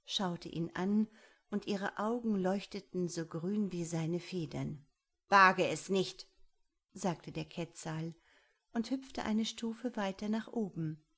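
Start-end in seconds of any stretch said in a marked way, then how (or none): 5.3-6.3 loud, forceful warning; firm and loud, standing your ground; sharp warning. A narrator's reading. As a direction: warn off a predator. Bird warns off a puma.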